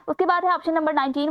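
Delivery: usual speaking voice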